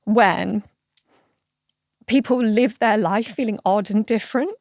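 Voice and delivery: fighting tears as she talks of her brother; holding back tears. Real, not acted. Guest choking up over her late brother. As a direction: voice catching, slowed and halting.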